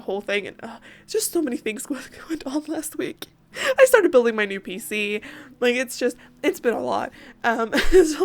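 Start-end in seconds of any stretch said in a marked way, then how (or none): 0.5-3.3 breathy whispered aside; whispering. Real, not acted. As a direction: drop to a whisper. Aside about a hectic week.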